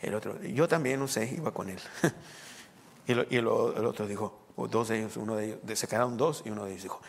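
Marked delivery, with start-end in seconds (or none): none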